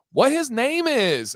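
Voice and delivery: put-on high, drawn-out child's question; mock little-kid voice; imitating little daughter. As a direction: pitch it way up and stretch it like a small kid asking. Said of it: "and she goes".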